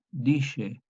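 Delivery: dice with a sh sound, DI-she; old Spanish sh. Shown as a demonstration. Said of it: "Y suena así como dice".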